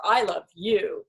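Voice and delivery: slow build to a long, weighted "you"; "you" drawn out and stressed. Shown as a demonstration. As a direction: save the emphasis for the last word, "you". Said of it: "put the emphasis on a different word".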